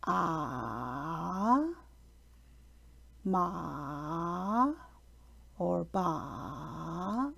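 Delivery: ah, ma, ba each dipping low then rising in third tone. Shown as a demonstration. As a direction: drop ah, ma, ba low, then lift them. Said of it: "for the third tone would be".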